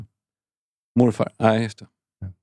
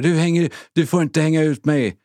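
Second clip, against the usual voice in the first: imitating an angry neighbour, raised pitch, drawn out; mock yell at normal volume, higher and slower; angry shouting neighbour; 5 semitones higher than usual. Angry neighbour accuses the podcaster of exposing him, retold angry yell. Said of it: "Han skrek, var så jävla arg".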